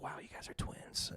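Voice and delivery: whispered, airy wow; a whispered imitation of amazed fans; hushed mock awe. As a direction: breathe it like a whisper. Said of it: "people were just like".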